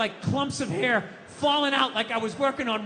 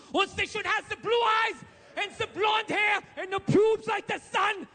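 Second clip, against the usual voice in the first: mock German accent; 8 semitones higher than usual. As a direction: ham up a German dictator's shouting. Hitler barges into the train.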